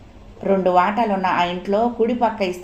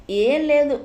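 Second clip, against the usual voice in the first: higher, called out across the kitchen; 7 semitones higher, slower than usual. A narrator's reading. Wife shouting back to the master from the kitchen. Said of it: "అరిచి".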